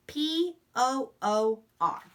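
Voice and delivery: letters spaced slowly and evenly; slow spelling out. Shown as a demonstration. Teacher spelling a sight word aloud slowly.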